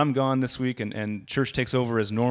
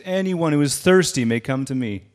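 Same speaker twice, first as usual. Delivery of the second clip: a touch louder call; raised voice; 4 semitones higher, 6 dB louder than usual. Retold or imitated. Preacher echoing Jesus calling in the street, mildly raised. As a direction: lift the voice to the crowd. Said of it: "When Jesus raises his voice".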